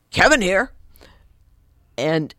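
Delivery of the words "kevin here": loud, punchy "Kevin here"; military phone greeting. Retold or imitated. Ex-Marine client answering his phone, military abruptness. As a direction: answer like a Marine reporting in, raised pitch. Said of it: "he was a big military man".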